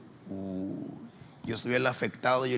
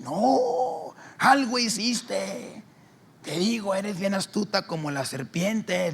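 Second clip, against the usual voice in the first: higher, louder upset woman's voice; sister retelling; 8 semitones higher, 5 dB louder than usual. Retold or imitated. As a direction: tell it all worked up.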